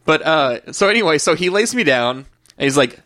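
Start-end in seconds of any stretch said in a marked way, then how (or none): none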